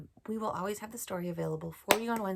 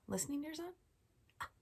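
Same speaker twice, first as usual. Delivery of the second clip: usual speaking voice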